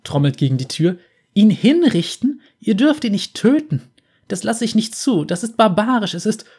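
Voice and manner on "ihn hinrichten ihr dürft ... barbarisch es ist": loud, high outraged pleading; outraged protest. Acted. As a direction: shout through the door in outrage. Lux outraged at execution.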